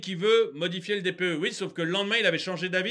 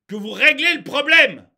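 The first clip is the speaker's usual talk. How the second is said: loud, indignant finish; angry punchline; 5 semitones higher, 9 dB louder, slower than usual. Real, not acted. Ranter tells officials how to fix it, loud exasperation. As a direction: drive it home with a shout. Rant climax at the authorities.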